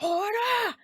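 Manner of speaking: mock tough-girl yell; imitated angry gangster girl. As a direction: imitate an intense yakuza-accented anime shout. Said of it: "intense and angry sounding".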